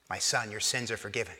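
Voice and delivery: very soft, low reassurance; tender. Retold or imitated. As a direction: forgive him gently, almost under the breath. Voicing Jesus forgiving the paralytic.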